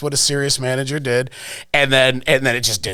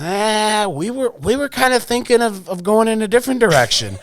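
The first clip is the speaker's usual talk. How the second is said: higher, hesitant imitation of the boss; a sheepish higher mock boss voice; mimicking the boss; 9 semitones higher than usual. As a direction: play him squirming and awkward. Retelling boss Jeff's awkward reply.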